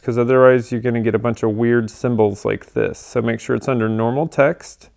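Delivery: usual speaking voice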